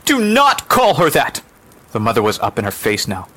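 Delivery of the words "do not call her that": slow, high, loud rebuke; furious rebuke. Acted. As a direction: forbid it word by word. Furious mother rebukes the woman.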